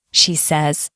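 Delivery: plain reading voice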